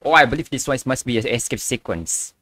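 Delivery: ordinary talk, nothing marked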